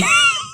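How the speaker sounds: high-pitched burst of laughter; real giggle fit; helpless laugh. Real, not acted. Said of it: "making fun of this".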